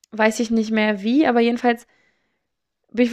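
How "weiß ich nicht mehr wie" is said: ordinary talk, nothing marked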